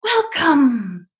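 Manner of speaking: high, long-held welcome; grand welcome. Retold or imitated. Drive-thru guy as Oz gatekeeper, grandly welcoming. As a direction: sing it out like opening the gates of Oz. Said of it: "That's what it felt like as soon as we pulled up".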